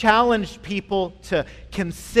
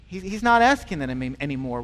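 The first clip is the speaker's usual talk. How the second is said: ordinary talk, nothing marked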